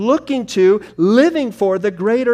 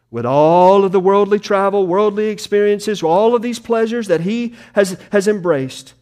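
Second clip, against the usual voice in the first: ordinary talk, nothing marked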